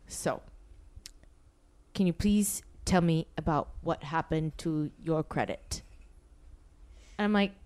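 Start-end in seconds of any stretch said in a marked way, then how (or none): 0.1-5.8 low, slow, quiet interrogation; stern older manager, slow and soft; all-business manager. Retold or imitated. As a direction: lower and measured, strictly business. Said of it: "he was all business".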